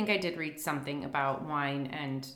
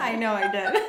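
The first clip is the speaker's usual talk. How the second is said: laughing; 3 semitones higher, 4 dB louder than usual. Real, not acted. Laughing admission.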